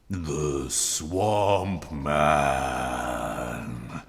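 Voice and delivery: low, slow eerie storytelling; slow low creepy telling; spooky narration. A narrator's reading. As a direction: build dread slowly. Spooky movie description.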